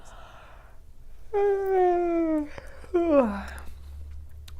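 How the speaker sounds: high sigh falling slowly; long demonstrated sigh. Shown as a demonstration. Teacher showing how to release chatter, long falling sigh. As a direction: let out a long audible exhale, sliding down.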